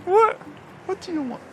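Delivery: very high, loud dog-talk voice; squeaky praising a dog. Real, not acted. Talking to the dog.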